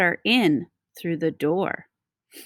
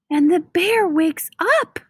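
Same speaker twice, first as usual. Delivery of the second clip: ordinary delivery, nothing marked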